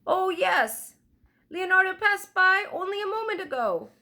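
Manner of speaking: put-on a chatty old weaver woman, eager to help; toothless old woman.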